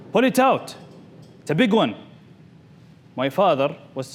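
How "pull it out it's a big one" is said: retold shout. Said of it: "my uncles were shouting".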